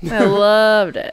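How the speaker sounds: loud, slow mock gush. Teasing mock enthusiasm.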